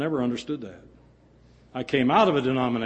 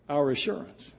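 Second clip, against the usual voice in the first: normal talking voice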